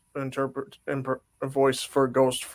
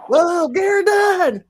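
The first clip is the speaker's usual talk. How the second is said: high, slow excited whoa; excited shout; 7 dB louder, slower than usual. Real, not acted. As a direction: shout it out in delight.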